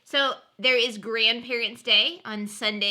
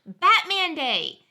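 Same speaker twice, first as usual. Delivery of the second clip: excited; 6 semitones higher, 5 dB louder, slower than usual. Acted. Announcing holiday excitedly.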